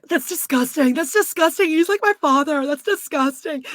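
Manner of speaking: high, loud mock disgust; hammed-up disgusted squeal; mock disgusted Lisa. Mocking Lisa's disgust.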